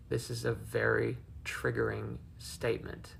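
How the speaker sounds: normal talking voice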